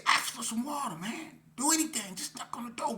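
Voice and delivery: bossing a nervous partner to just knock; urgent hissed order. Retold or imitated. Urging friend at the door.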